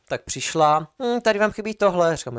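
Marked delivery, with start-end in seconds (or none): 1.0-2.2 high, nagging voice of a fussy lab assistant; mocking lab assistant. Lab assistant nitpicking the students' bench, high mocking voice. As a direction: mimic her petty complaint, pitched up.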